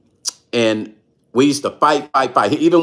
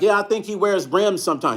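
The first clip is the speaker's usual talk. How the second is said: higher, wide-eyed clueless reply; naive answer; 6 semitones higher, 3 dB quieter than usual. Retold or imitated. Repeating to the stranger, still clueless, higher and wide-eyed. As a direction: play it completely innocent. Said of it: "that's how naive I was".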